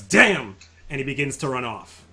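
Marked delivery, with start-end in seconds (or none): 0.0-0.6 sharp loud higher curse; shouted curse. Acted. Captain seeing the tower fall, shouts loud. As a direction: spit it out in alarm. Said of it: "just shouts".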